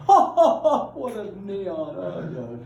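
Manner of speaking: loud laugh through the words; cracking up at the joke. Real, not acted.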